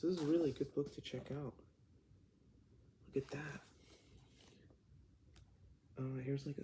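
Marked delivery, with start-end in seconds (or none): 3.1-3.6 soft whispered aside; quiet at night. Real, not acted. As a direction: breathe it out in a whisper. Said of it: "I'm kinda whispering because it's late".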